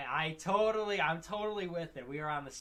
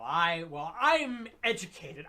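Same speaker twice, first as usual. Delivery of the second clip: haughty snob; 2 semitones higher, 6 dB louder, slower than usual. Retold or imitated. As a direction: sneer it like a know-it-all. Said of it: "that haughty".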